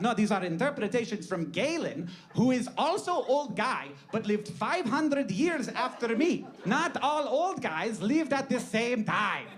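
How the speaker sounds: high, indignant put-on accent, slowing at the end; hammed-up accented protest; Hippocrates. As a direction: correct them as a miffed ancient physician.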